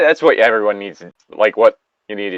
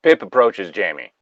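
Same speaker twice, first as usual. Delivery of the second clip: plain reading voice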